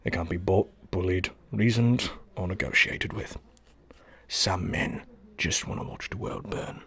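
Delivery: low, quiet, measured English butler voice; lightly voiced Michael Caine, calm and grave; a hint of the old butler explaining the villain; Michael Caine impression. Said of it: "Yeah, Michael Caine".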